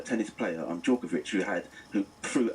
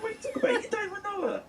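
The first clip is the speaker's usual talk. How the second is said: much higher, disbelieving protest; retold shocked outburst, pitched way up; incredulous retort; much faster than usual. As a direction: squeak out his disbelief at his cousin. Retelling his shocked reply to cousin.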